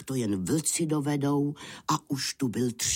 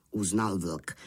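plain reading voice

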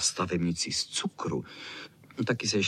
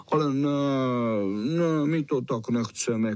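slightly raised dog voice; mild shouted protest; 3 semitones higher, 3 dB louder than usual. Acted. Fox Terrier objects to singing, mildly shouting. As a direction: protest a little louder. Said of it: "křičel Fox Terrier".

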